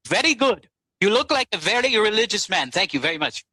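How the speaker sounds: quoting the Indian doctor in a lightly put-on Indian accent. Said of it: "I gotta do the accent," because he was an Indian".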